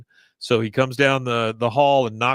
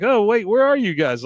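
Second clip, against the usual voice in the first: high, loud mock confusion; disoriented girl; 10 semitones higher, 5 dB louder than usual. Serena spun around in a blindfold, high bewildered calling. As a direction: imitate a lost girl calling out, pitched up. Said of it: "she's dizzy".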